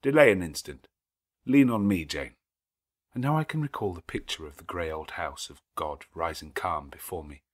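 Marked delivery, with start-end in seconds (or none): 0.0-2.4 louder, lightly voiced man offering his arm; a hint of a caring groom; lightly voiced Rochester, urging her to lean on him; Rochester steadying her. A narrator's reading. Rochester voiced in read novel.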